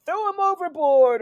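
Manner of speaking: high, loud rallying cry; mock pirate shout. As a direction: call out to the crew like a riled-up pirate. Acting out a hostile pirate's order.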